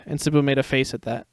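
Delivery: usual speaking voice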